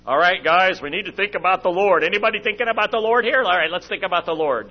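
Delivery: forced cheer. Retold or imitated. Dad lecturing kids in van.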